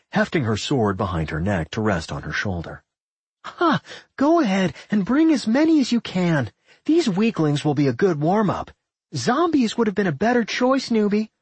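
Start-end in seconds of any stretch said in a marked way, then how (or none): 3.4-11.3 high-pitched cocky taunt; mocking. A narrator's reading. Nat taunting a newbie necromancer before the fight, mocking. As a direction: goad a beginner, pitched right up. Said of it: "Nat mocked".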